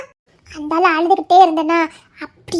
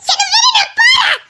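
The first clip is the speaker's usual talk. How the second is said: angry shouting; 11 semitones higher than usual. Acted. Daughter refuses angry father.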